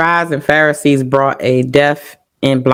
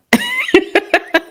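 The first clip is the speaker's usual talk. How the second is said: a high, shaky laugh to cover tears; tearful laugh. Real, not acted. Laughing to hide tears while telling her son's story.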